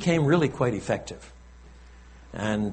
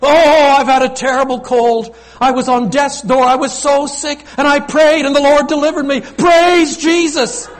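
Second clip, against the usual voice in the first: much higher and much louder, hammed-up gushing testimony; charismatic enthusiasm; 13 semitones higher, 11 dB louder than usual. Preacher mimics an effusive charismatic believer, hammed-up gushing. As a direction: gush it out like an overexcited revival believer. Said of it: "add a bit of charismatic enthusiasm on top".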